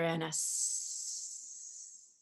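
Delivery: slow whispered hiss trailing off; snake hiss. Retold or imitated. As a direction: let it fade into a hiss.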